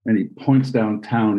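ordinary delivery

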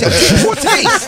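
mock him in a high slow squeal; a high slow silly mimic of the guy. Mocking repeat of clip line.

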